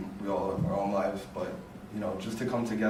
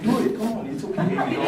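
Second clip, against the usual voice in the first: coaxing friend; 12 semitones higher, 4 dB louder than usual. Retold or imitated. Friend coaxing her to drive.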